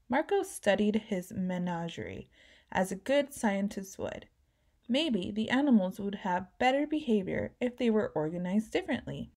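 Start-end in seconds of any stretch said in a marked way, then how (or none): none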